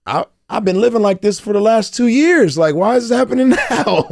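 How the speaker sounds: loud, high, incredulous outburst with a laugh; laughing, exasperated cry of why now; exasperated disbelief. Retold or imitated. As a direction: throw up your hands in disbelief. Retold own exasperated outburst.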